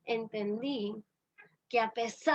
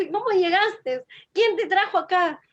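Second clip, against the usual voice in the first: higher, mildly startled questioning; retold surprise; 5 semitones higher, 4 dB louder than usual. Father asks the child who suddenly appeared, mildly surprised. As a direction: ask with a touch of astonishment. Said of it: "Él se puso así como que estaba sorprendido".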